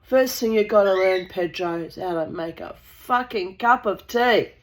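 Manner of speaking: ordinary talk, nothing marked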